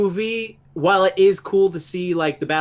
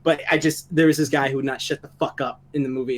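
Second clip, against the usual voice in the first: ordinary talk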